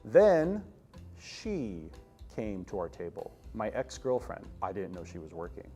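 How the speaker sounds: ordinary talk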